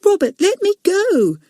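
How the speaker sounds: put-on high, pleading little-boy voice; begging small boy. Leslie begs Robert, high pleading voice. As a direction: beg to be released, sounding small. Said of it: "begged Leslie again".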